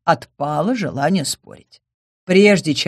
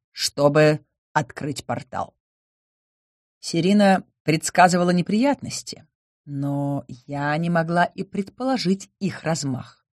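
ordinary delivery

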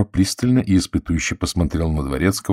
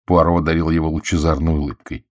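plain reading voice

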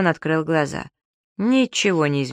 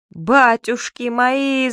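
higher, louder, slow drawn-out cry of shock; 7 semitones higher, 4 dB louder, much slower than usual. A narrator's reading. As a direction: cry out at the sight in the mirror. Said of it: "закричал он".

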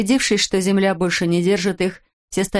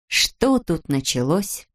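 ordinary delivery, nothing marked

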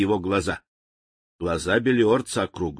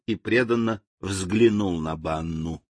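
plain reading voice

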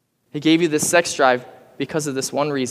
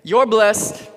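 call and response; 5 semitones higher than usual. Shown as a demonstration. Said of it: "Turn to your neighbor and say this".

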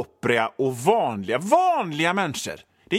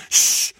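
shushing; 5 dB louder than usual. Retold or imitated. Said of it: "Och kvinnan, hon".

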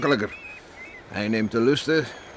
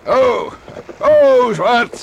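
very loud, high gasping oh to the horse; excited cries; 11 semitones higher, 10 dB louder, much slower than usual. Acted. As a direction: cry out as the race peaks. Racing horses, neck and neck. (luide opgewonden kreten bij de finish)

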